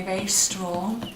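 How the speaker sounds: ordinary delivery, nothing marked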